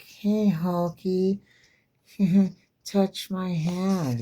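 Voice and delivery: put-on high, slow, coaxing kid voice; creepy little girl voice. Comic book girl luring Hulk, high slow coax. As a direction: lure him in, sing-song and slow. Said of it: "She's like".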